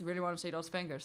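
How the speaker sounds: quick comic TV catchphrase voice; a light nod to a TV comedy bit; Vic and Bob catchphrase. Retold or imitated. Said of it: "a well-known phrase from a, from a T V program".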